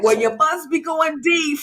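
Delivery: a hint of put-on scolding; Elder Mother. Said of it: "She's like".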